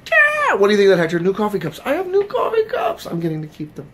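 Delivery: loud, high-pitched excited crowing; playful gloating. Pretend. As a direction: shout it high, showing off to Hector. Gloating over new cups.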